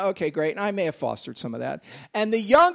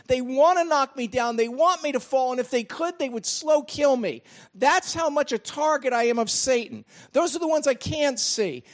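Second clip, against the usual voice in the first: full-force preaching; 7 semitones higher than usual. Acted. Pastor rousing the congregation, in full preaching voice. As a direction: lift the voice and drive it home to the congregation.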